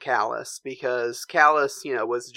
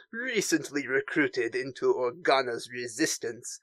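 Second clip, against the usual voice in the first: softer French-accented cartoon villain briefing; 3 semitones lower, 5 dB quieter than usual. Agent Terex describing a young Republic pilot, French accent. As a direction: read it out in a Pepe Le Pew French accent. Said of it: "I want to do a live reading".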